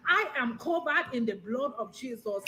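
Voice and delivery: put-on rapped Nigerian accent. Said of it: "The Nigerian has been trying".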